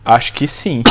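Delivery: normal talking voice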